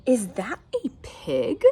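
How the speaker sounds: slow, breathy disbelief; disapproving neighbour. Acted. Grumpy neighbour spotting the pig, hushed and appalled. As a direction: ask as if appalled. Eugenia voiced.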